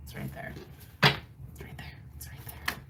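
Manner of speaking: soft repeated whisper; whispered insistence. Real, not acted. Reader whispers to water signs that it's right there. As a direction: breathe it out under the voice.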